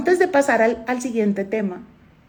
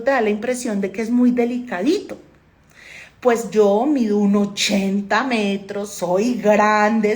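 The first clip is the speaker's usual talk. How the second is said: ordinary talk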